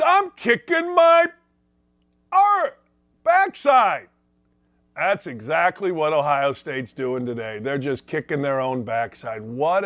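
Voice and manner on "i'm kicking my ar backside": higher, louder, slowed manic outburst; Jim Carrey quote. Retold or imitated. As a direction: play Jim Carrey beating himself up. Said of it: "And he says".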